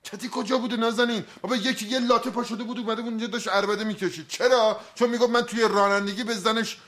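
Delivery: agitated pleading. Acted. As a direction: talk fast and defensive, pitch up. Agitated, defensive explanation. (با هیجان و دستپاچگی)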